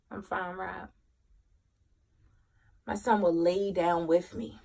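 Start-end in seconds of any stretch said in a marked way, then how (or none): 0.1-0.9 very soft, low murmur; retold weary reply. Depressed mom reassures her son from bed, weary and quiet. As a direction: brush off concern in a small, low voice.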